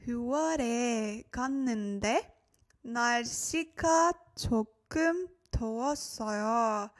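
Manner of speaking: slow, phrase by phrase with pauses. Shown as a demonstration. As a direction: read it slowly for learners, spacing each word group. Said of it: "let me repeat a little bit slower for you guys".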